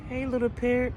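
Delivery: high sugary call to a parrot; sweet greeting. Retold or imitated. Lady cooing to the parrot, high and sweet. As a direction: coo up at the bird.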